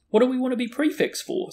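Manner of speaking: high, loud incredulous protest; revolted. A narrator's reading. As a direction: recoil at the very idea. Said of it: "looking revolted at the very idea".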